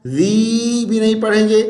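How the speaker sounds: usual speaking voice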